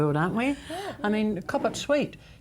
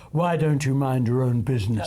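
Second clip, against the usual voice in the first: lower, firm schoolmarm scolding; prissy strict schoolteacher voice; 5 semitones lower, 3 dB louder than usual. Retold or imitated. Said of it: "in my best schoolmarm voice".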